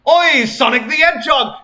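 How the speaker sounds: a first go at a loud, high cheery British greeting. Acted. Neighbour Tracer greets Sonic across the curb, loud cheery British call. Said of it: "barked Tracer in a cheery British voice".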